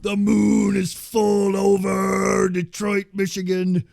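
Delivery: booming high shout with long drawn-out words; hammed-up screaming cult-leader promo; screaming Master voice. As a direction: wail it out as loud as you can, stretching the syllables. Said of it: "he's doing this voice".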